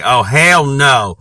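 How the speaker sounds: high, loud, drawn-out "Oh, hell no" in a mock trashy voice; mocking sassy girl. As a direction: ham up the sassy girl's outrage. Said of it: "get vocal with some shenane".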